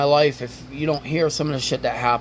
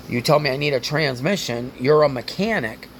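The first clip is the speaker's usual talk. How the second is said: ordinary talk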